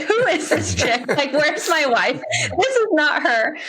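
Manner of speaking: a light mock of the husband's confusion; imagined baffled husband. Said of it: "he's probably like".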